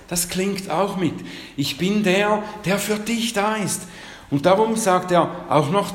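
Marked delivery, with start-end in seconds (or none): 1.6-3.8 high and heartfelt, God's promise; fervent voice of God. Acted. Preacher repeating God's words to the listeners, fervent and high. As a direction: sing it out as a promise.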